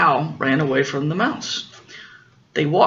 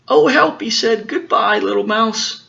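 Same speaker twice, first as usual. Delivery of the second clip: high, loud frightened yelp; scared fox; 8 semitones higher, 3 dB louder than usual. Acted. Fox fleeing the Gruffalo, high and loud in fright. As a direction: squeal it like a panicking fox. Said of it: "and he got scared".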